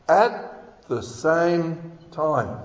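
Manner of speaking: "at the same time" drawn out, pausing after "at"; very slow, deliberate "at the same time"; slow emphatic. Real, not acted. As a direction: land each word of "at the same time" separately. Preacher drives home the verse's point.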